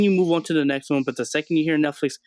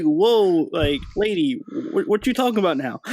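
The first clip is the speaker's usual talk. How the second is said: incredulous retort; 4 semitones higher than usual. Retold or imitated. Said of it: "it's like".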